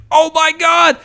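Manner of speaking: imitating a hyped crowd, shouting high and loud; thrilled audience. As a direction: erupt like a hyped theater crowd. The theater erupted again.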